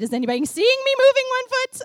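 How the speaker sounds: high, very loud comic fretting; hammed-up self-conscious squeal; mock nervous panic. Worshipper afraid of being watched, loud mock panic. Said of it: "I'm feeling very nervous".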